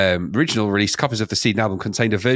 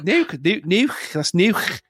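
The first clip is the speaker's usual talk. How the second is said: high-pitched mock Scouse with throaty final k; Scouse accent; 9 semitones higher, much slower than usual. Shown as a demonstration. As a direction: try the word in exaggerated Scouse. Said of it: "That's Scouse".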